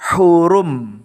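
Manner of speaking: Hurum, closing on a held m with no vowel; Hurum with silent-ended m. Shown as a demonstration. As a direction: end Hurum on a plain m. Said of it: "Mimnya dibaca sukun".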